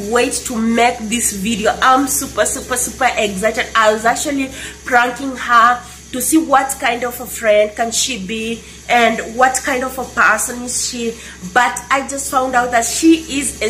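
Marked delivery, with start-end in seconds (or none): none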